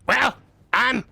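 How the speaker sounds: high, loud goblin. Pretend. Game master playing a captured goblin for the players, goblin voice. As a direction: squawk it out as a high-pitched little goblin. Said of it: "I should do a Goblin voice".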